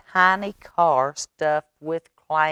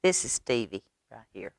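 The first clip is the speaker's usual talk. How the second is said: ordinary delivery, nothing marked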